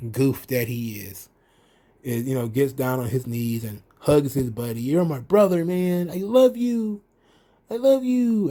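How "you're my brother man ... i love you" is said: mock emotional Roderick Strong pleading with his brother; gullible tearful brother. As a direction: play the goofy heartbroken friend. Gullible friend voiced again.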